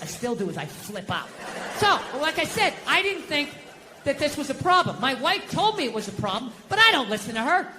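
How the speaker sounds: normal talking voice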